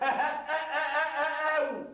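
a short mocking burst of laughter; scornful laugh. Husband laughing off his father-in-law's claim to the house, mocking laugh. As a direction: laugh in his face, derisive. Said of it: "ليه بتضحكني".

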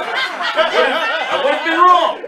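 drawn out very slowly through laughter; laughing. Real, not acted. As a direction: stretch it out, cracking up. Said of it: "comic overjoyed host".

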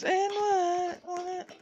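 slow high crying wail; shocked cry. Real, not acted.

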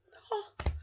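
a high, breathy startled gasp; sharp gasp. Retold or imitated. Seeing a stranger at her window, a startled gasp. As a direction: suck in air as if you've just spotted someone.

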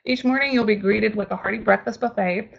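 normal talking voice

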